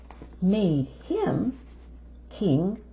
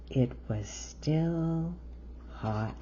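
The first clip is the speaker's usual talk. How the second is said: slow, hushed close; ending the bedtime story softly; 2 semitones lower, 6 dB quieter, slower than usual. A narrator's reading. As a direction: land the last word gently.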